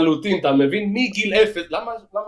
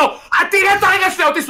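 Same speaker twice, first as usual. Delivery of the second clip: high, loud mock yell at the doctor; retold yell, much higher and louder; mock shout; 14 semitones higher, 4 dB louder than usual. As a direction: shout it at him like a fed-up patient. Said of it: "אני אומר לו".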